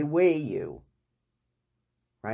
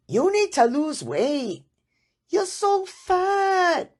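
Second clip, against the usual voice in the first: put-on impersonating a Hong Kong-accented doctor, very high pitched and slow; hammed-up Hong Kong accent, pitch soaring on so fat; 5 dB louder, slower than usual. Doctor repeating her blunt verdict to patient, Hong Kong accent, very high. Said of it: "with her Hong Kong accent".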